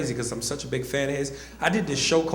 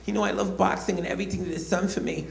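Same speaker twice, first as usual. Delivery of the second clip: earnest, raised-pitch malapropping champ; Mike Tyson impression; 3 semitones higher, 4 dB quieter than usual. Comic doing the boxer to his face, Tyson impression. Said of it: "I did the impression".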